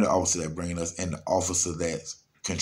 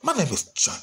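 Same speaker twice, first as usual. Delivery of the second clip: lightly raised mocking greeting; mock John voice; 11 semitones higher than usual. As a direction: tease the guy's introduction, a bit higher.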